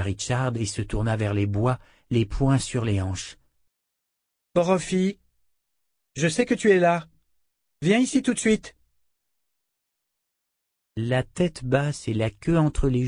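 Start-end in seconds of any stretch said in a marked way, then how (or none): 4.5-8.7 higher-pitched, commanding call; appel autoritaire. Acted. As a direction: summon someone hiding, no arguing. Callan appelle le loup caché. (appel sec et autoritaire)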